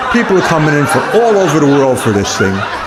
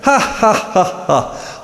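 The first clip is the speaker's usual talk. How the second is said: mock laugh; 8 semitones higher, 3 dB quieter, slower than usual. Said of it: "My wife starts laughing".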